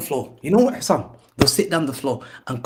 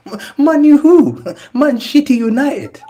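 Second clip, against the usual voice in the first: high, loud laughing jeer; jeering through laughter; laughing mockery; 12 semitones higher, 8 dB louder, slower than usual. Real, not acted. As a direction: cackle the words out mockingly. Mocking rival fans, laughing.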